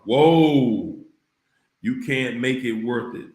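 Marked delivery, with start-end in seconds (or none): 0.0-1.0 loud startled whoa; stunned exclamation; surprised whoa. Real, not acted. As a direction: react like the comment hit hard. Reacting to super chat.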